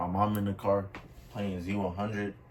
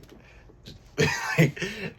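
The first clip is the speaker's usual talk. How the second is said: high laugh; 11 semitones higher than usual. Real, not acted. Laughing at his memory.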